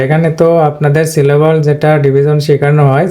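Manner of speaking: ordinary talk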